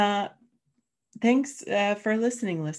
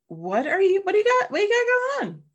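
excited; 14 semitones higher, 6 dB louder, faster than usual. Real, not acted. Playful host question.

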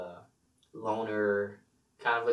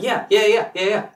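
raised, louder excited patter; louder and quicker; 5 semitones higher, 4 dB louder than usual. Shown as a demonstration. Player mocking his own excitable habit, louder and quicker. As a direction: fire it off eagerly, higher and louder.

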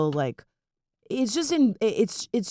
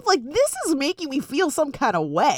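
mock disbelief; 9 semitones higher, 4 dB louder than usual. Host retelling her reaction to coloring book, mock disbelief. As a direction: act out bafflement, pitched way up.

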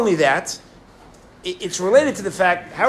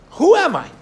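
anguished cry; 11 semitones higher, 7 dB louder than usual. Retold or imitated. Soldier's cry of confusion.

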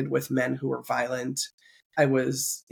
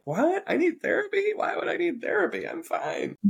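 high-pitched disbelieving protest, dropping away at the end; squeaky-high imitation of her former self in denial; mock incredulity; 3 dB quieter than usual. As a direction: play up offended denial, voice shooting way up. Acting out her old denial.

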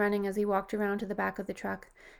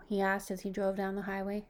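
ordinary delivery

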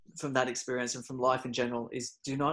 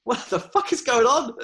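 shocked disbelief; 10 semitones higher, 7 dB louder than usual. Retold or imitated. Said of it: "And I'm like".

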